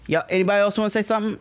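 fast, laughing dare to the crowd; laughing challenge. Retold or imitated. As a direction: taunt the room with a laugh.

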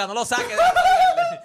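high squealing laugh through the words; laughing mock scold. Laughing as he imitates Adele telling the guard off. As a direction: crack up while imitating the scolding. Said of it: "Adela le iba a decir".

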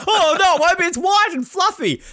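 very high, loud gushing imitation; mock naive protest. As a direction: insist excitedly, like a naive relative. Retold naive relatives protesting.

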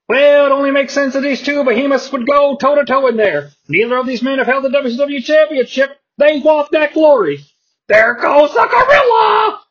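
high, excited ringside call; play-by-play announcer. Acted. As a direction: call the action like a wrestling announcer. Announcer calls the match.

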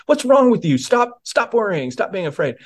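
put-on high, exasperated scolding of a scared child; mock scolding parent. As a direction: snap at her like an impatient parent. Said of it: "I'm not commanding her".

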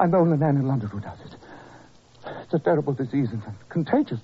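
normal talking voice

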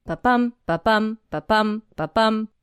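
"ba-bum" repeated as an even pulse, stress on BUM; steady iambic thump on every "ba-bum", separated by short gaps; heartbeat rhythm. Shown as a demonstration. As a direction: beat out each "ba-bum" like a heart, weak then strong. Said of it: "mimics the sound of a beating heart".

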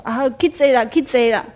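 urging. Retold or imitated. Mother-in-law urging the speaker to go meditate, loudly. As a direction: hurry her along to meditate.

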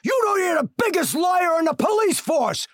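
high, loud courtroom outburst; shouting down the lying cop; furious shout. Acted. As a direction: yell the accusation across the court. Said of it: "I stood up and I said".

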